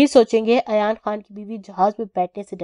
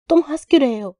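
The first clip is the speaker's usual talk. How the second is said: higher, teary and innocent asking; tearful innocent question; 5 semitones higher than usual. A narrator's reading. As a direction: ask through tears like a hurt child. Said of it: "روتے ہوئے معصومیت سے پوچھ رہی تھی". (روتے ہوئے معصومیت سے سوال)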